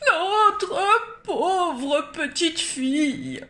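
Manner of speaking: slow, high, loud wailing sob; sobbing. Acted. Parents sobbing over their little girl. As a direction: weep over the lost daughter. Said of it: "sanglotèrent ses parents".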